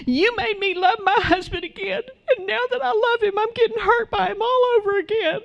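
high, whiny crying complaint; mock tearful wail. Upset wife to her pastor, mock tearful wail. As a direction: imitate a woman wailing, high and thin, speeding up.

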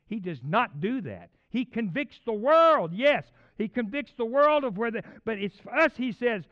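loud, high, urgent sermon delivery; full-force preaching. Real, not acted. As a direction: preach it hard, punching each phrase.

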